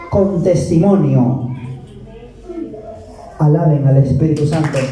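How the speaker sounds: normal talking voice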